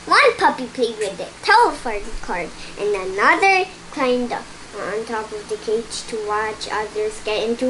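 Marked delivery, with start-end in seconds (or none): none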